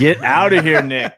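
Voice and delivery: loud teasing get out; playful shout. Real, not acted. Host teasing a buddy who just said thanks, loud playful shout. Playful shove-off among hosts.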